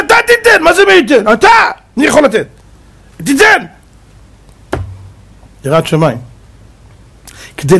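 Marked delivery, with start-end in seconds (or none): 0.1-3.6 much higher, insistent outcry; urgent demand. Acted. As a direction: exclaim אתה תיתן high and urgently. Demanding the reply Moses missed.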